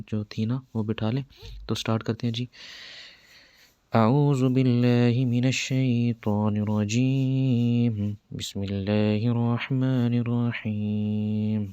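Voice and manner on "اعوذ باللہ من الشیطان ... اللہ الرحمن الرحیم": slow, chanted recitation; slow, reverent Arabic chant; Quran recitation. Acted. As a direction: intone it slowly like tilawat.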